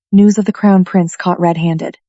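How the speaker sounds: ordinary delivery, nothing marked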